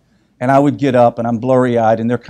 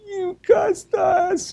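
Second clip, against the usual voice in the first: put-on much higher, slow, stunned child voice; shocked kid; much slower than usual. Son shocked that dad swore at him, high slow childish voice. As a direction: gasp it out like a scandalized little boy. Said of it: "And then Michael was like".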